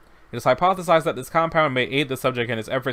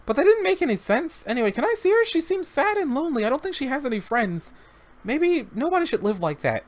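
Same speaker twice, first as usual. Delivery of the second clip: put-on high little boy's voice, a touch sad; the child pleading for his lonely friend; young boy, wistful; 12 semitones higher than usual.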